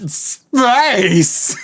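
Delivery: high theatrical exclamation, laughing; Tim Curry as Soviet general. Retold or imitated. Cohost quoting the game line, hammy villain exclamation. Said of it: "He says".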